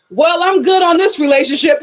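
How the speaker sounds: loud, high-pitched announcement; half-laughing emphatic outburst; emphatic mock declaration. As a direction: proclaim it loud and high, amused. Acted declaration of leaving relationship.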